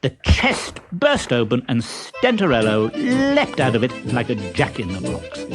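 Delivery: plain reading voice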